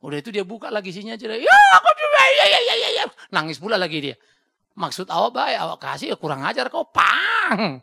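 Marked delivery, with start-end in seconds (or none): 1.3-3.0 put-on shrill, very high screaming child; child's shout. Kid yelling back at the parent over a present, shouting. 4.7-7.8 loud scolding father; angry parent. Retold or imitated. Father scolding the crying child at the birthday, loud and angry.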